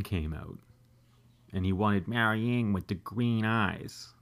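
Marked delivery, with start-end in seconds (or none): 1.5-4.1 creepy movie-villain impersonation with a put-on accent; a creepy, accented sorcerer voice; Lo Pan. Comedian impersonates the villain who wanted the green eyes, creepy accented voice. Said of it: "that's how he sounded".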